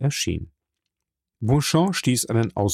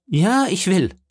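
high, emphatic retort; defiant insistence; 9 semitones higher, 3 dB louder than usual. Acted. Viscount answering the doubting journalist, defiant and insistent. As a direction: throw it back high and determined.

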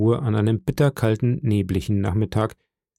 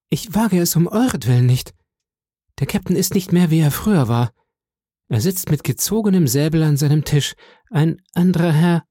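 frightened plea; 6 semitones higher than usual. A narrator's reading. Scared boy pleading with beggar.

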